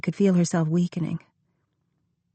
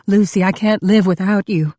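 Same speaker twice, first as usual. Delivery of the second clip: desperate plea; 2 semitones higher, 6 dB louder than usual. A narrator's reading. John pleading as she leaves.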